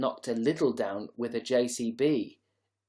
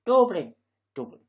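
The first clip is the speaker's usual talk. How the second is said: trying out a Dublin accent on "Dublin"; Dublin accent attempt; 3 semitones higher, 6 dB louder than usual. Shown as a demonstration. Streamer working out a Dublin accent, tentative attempt. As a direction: test the word "Dublin" in a Dublin accent. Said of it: "how do you do a Dublin accent".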